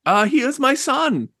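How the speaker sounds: put-on high, frail old mother; old woman voice. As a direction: speak as a hopeful old woman.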